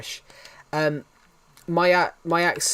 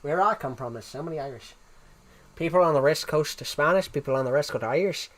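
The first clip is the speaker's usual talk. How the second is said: softer put-on Irish accent; imitation Irish with a lilt; 3 dB quieter than usual. Shown as a demonstration. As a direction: demonstrate an Irish brogue. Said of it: "I can do an Irish accent".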